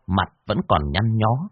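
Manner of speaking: plain reading voice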